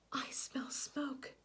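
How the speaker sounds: slow, hushed, alarmed whisper; gasp, then whisper the danger; frightened whisper. Acted. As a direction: breathe it out, scared of the smoke.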